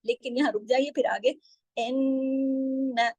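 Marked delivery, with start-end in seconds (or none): 1.8-3.1 इन्ना with a long nasal ghunna on the double न; slow tajweed recitation. Shown as a demonstration. Teacher showing the ghunna to students, slow. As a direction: hum the doubled न in इन्ना slowly. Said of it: "नून पे तशदीद है".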